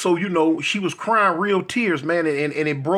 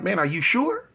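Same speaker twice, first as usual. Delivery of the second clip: doubtful, rising double check; concerned questioning; 4 semitones higher than usual. Retold or imitated. Friend doubting the plan to get K-Dog, concerned questioning. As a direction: press him with rising, unsure questions. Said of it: "had concerns like".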